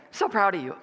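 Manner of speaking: tossed-off, careless praise; offhand, throwaway compliment; flippant. Acted. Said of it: "Sometimes we'll flippantly say".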